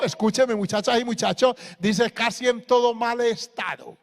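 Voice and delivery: loud, impassioned pulpit warning; preacher scolding young people, rising and forceful; fiery preaching. Acted. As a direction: press the warning hard on the youth. Pastor preaching at full force.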